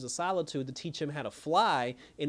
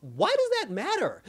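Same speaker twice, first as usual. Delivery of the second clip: high, pushed-up disbelieving question; exasperated why does that matter; incredulous; 12 semitones higher, 4 dB louder than usual. Retold or imitated. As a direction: ask it like the logic makes no sense. Incredulous reply to sister's accusation.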